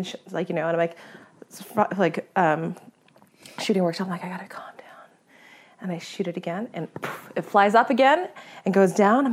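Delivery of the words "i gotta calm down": breathy whisper to oneself; calming self-talk. Retold or imitated. Hunter calming herself before shooting, whispered. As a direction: whisper it to steady your nerves. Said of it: "really slow my breath down".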